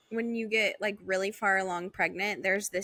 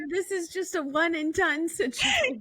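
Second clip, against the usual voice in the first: higher, half-laughing one-and-done line; laughing mock vow; 8 semitones higher than usual. Friend jokes about one pregnancy, laughing. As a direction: tease it through a laugh. Listener voices friend's thought.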